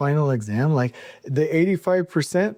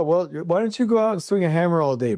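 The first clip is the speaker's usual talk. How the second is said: ordinary delivery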